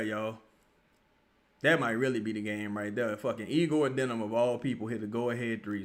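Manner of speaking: usual speaking voice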